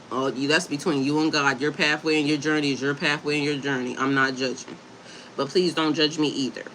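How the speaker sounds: ordinary delivery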